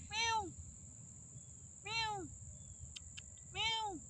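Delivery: high, mewing cat sounds; playful high kitty meows. Pretend. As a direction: meow like a little cat. Imagining a cat in the casket.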